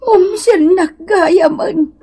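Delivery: high loud sobbing, slow and broken; sobbing cry. Acted. Mother sobbing loudly at her daughter's words. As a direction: wail it out through sobs, dragging each word.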